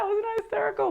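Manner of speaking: ordinary talk, nothing marked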